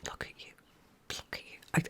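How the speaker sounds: whispering over the incubator in awe; tender whisper. Real, not acted. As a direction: breathe it softly, full of wonder. Tender whisper to heart-marked duckling.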